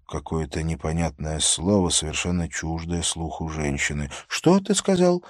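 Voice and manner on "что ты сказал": high startled question; surprised. A narrator's reading. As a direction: ask it in sudden surprise. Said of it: "спросила она с удивлением".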